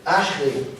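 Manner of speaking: teacher sounds out "Ashrei" slowly; Aramaic word Ashrei. Shown as a demonstration. Teacher shows the class how Jesus said "Ashrei". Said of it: "Mas seria".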